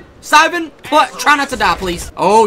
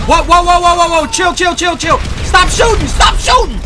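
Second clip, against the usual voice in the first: panicked yelling; 5 semitones higher than usual. Real, not acted. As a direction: yell for them to stop. Under heavy fire.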